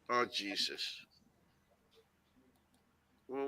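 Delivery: normal talking voice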